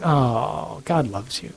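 soft, coaxing voice on the shoulder; hushed, soothing little devil's whisperings; little evil tempter. Acted. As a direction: lull them softly with false reassurance. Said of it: "that little voice is on your shoulder going".

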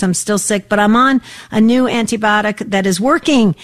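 ordinary delivery

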